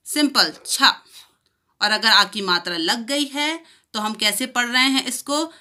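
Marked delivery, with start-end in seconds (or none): none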